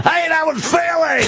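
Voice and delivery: shouting DJ. Retold or imitated. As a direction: yell it like a hyped-up jock. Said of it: "You can't be going".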